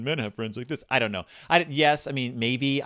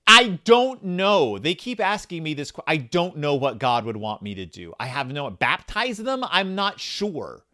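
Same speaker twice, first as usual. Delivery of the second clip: comically worked-up, raised-pitch protest; exasperated rant; 4 semitones higher, 5 dB louder than usual. Acted. Reader arguing with a book about what God wants, exasperated. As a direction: throw up your hands at an impossible question. Ranting at the book's question.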